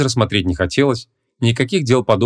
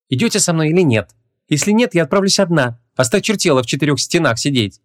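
higher-pitched exasperated heroine; raised voice, out of patience; Ванесса, fed up; 7 semitones higher than usual. Acted. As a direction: lay down an ultimatum in a female character voice. Fed-up heroine gives an ultimatum.

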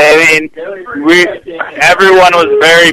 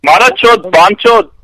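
shouted insults; 5 semitones higher, 5 dB louder than usual. Acted. Shouting Hindi insults into phone.

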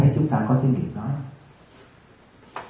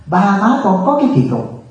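lightly voiced child, pitched up; complaining child; 8 semitones higher than usual. Child born abroad tells the monk about odd parents, lightly voiced complaint. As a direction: imitate a puzzled kid's complaint, higher. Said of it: "tôi trả lời một đứa nhỏ nó sanh tại Việt Nam".

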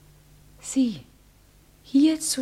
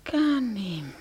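usual speaking voice

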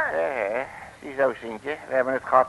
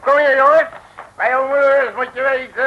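calling out; 8 semitones higher, 8 dB louder than usual. Acted. Calling the boys over.

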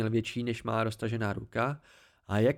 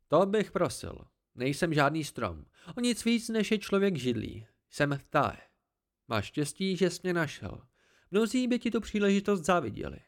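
higher, smug voice of the hidden Cthaeh; haughty tree creature; 5 semitones higher than usual. Acted. The Cthaeh corrects the boy, higher and smug. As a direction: talk down to the boy from the branches, pleased with yourself.

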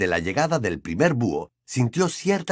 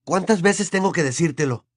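higher, mildly exasperated; Harry, raising his voice; 7 semitones higher than usual. A narrator's reading. Harry out of patience with his uncle, exasperated. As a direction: lift the pitch, losing patience. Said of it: "subiendo el tono de voz".

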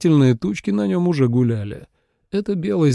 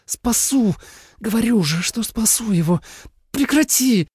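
desperate pleading; 9 semitones higher than usual. A narrator's reading. Snake in pain, begging.

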